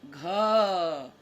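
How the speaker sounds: calls the letter out loud for the class. Shown as a demonstration. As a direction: ring the letter out so the kids repeat it. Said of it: "तो यहाँ लिखेंगे".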